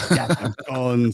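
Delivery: drawn-out mock Austrian accent; slow, hammed-up Austrian action-hero line; Arnold Schwarzenegger. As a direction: joke Austrian accent, vowels stretched. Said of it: "Was it Arnold Schwarzenegger?".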